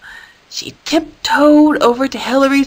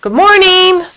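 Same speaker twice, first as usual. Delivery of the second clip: high, loud call; 10 semitones higher, 5 dB louder than usual. A narrator's reading. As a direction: call louder so she hears. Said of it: "called out again".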